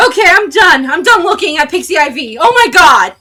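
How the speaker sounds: high-pitched, very loud disgusted outburst; appalled shouting. Real, not acted. Streamer finding the cursed image still there, appalled shouting. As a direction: cry out, utterly appalled.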